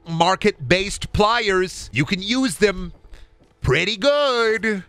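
high, slow drawn-out sales pitch; diner pitchman. Acted. Diner staff drumming up business, high and drawn out. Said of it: "they're trying to drum up business".

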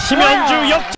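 very loud, high cry; excited comeback shout. Real, not acted. Commentator announcing the player's comeback, high excited shout. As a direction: burst out as the lead changes.

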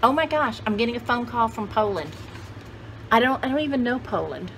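ordinary talk

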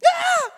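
a high, loud shriek of horror; shocked scream. Acted. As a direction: scream as she sees the baby. Mother discovers wrong baby.

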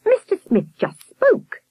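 high, drawn-out astonished voice. A narrator's reading. Miss Hilliard reporting Mister Smith spoke, astonished and high. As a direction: announce it slowly, pitched high. Alarmed hostess reports mute man speaking.

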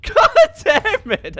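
laughing, stretched-out curse; laughing exasperation. Real, not acted. Laughing at a failed shot in the game. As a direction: laugh through the swear, slow and high.

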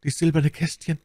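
high, strained deathbed voice; dying duke, wistful. A narrator's reading. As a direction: show treasured keepsakes with failing strength. Dying duke shows keepsakes. (sterbender Herzog, wehmütig)

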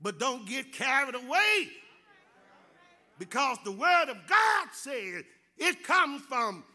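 rising, ringing preacher's cadence with loud peaks; preaching. Acted. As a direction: build the sermon to a shout. Preacher builds to full force.

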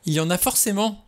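high, heated insistence; flaring up in exasperation; losing her temper. A narrator's reading. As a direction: snap back, refusing to be contradicted. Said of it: "s'emporta Vera".

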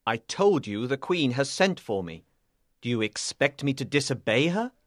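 low, cool rebuke; cold. Acted. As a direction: answer coldly as a proud knight. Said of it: "he asked coldly".

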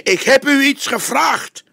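detective demanding an answer, angry. A narrator's reading. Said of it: "brieste hij".

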